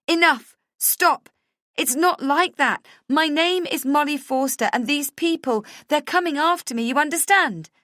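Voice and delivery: loud and high, shouting him down; a wife yelling at her husband to stop; furious shout. Acted. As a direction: scream it out, desperate to be believed. Jumps up, chair tumbles, shouting.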